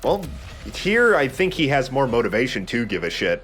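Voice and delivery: usual speaking voice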